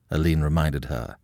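plain reading voice

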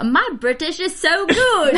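high, drawn-out attempt at British; hammed-up, badly faked British accent. Host retells boasting to the actor, bad British accent. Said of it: "that is the worst British accent I've ever heard".

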